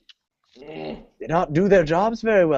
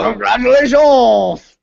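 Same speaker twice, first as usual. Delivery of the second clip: loud high congratulations; 4 semitones higher, 5 dB louder, slower than usual. Acted. Shouted congratulations echo.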